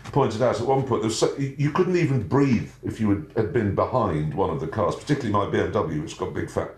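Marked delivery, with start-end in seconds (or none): none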